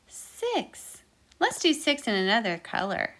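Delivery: ordinary talk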